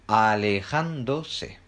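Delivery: ALEJÁNDOSE stretched out very slowly, syllable by syllable. Shown as a demonstration.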